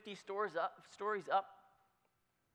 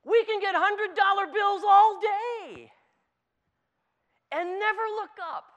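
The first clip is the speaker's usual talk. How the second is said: preaching at full force; 10 semitones higher, 8 dB louder than usual. Acted. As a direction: drive the point home from the pulpit.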